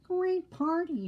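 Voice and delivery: high, slow pig voice; high piggy voice. Acted. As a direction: squeal it like a happy pig.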